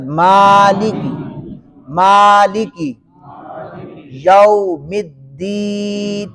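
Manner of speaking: مالکی slowly, "maa" held long, for repeating; مالکی یوم الدین in slow, separated pieces; read-along model. Shown as a demonstration. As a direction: lead learners through یوم الدین with a drawn-out "deen". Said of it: "پڑھیں میرے ساتھ".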